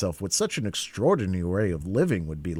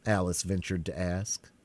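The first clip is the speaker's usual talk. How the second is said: ordinary delivery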